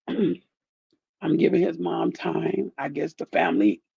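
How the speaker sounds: ordinary talk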